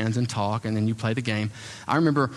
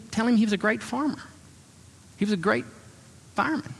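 high, bubbling excitement about the weekend; delighted gushing; 10 semitones higher than usual. Retold or imitated. A church lady telling her pastor about her weekend, thrilled. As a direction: gush like she can't believe her luck.

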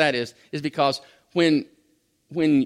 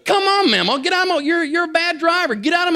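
hammed-up road rage, pitched way up through a laugh; mock impatient driver; 6 dB louder than usual. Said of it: "you know, the".

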